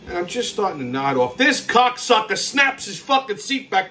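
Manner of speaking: ordinary talk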